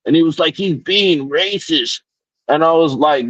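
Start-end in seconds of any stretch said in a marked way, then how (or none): 0.6-2.0 mock whine. Voicing the customer's accusation.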